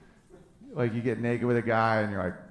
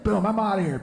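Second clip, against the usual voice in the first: quick, emphatic sign-off, pitched up; door-slam exit; 6 semitones higher than usual. Acted. Flip-phone owner hanging up on someone, quick emphatic exit. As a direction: say it briskly, like slamming a door. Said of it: "it's like slamming a door".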